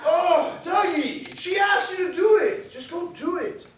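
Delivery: put-on high whiny kid voice, half laughing. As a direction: plead with your big brother, whining.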